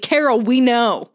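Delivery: loud, drawn-out exasperated neighbor; mock yelling. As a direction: yell it slowly like a fed-up neighbor online. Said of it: "People just screaming at each other".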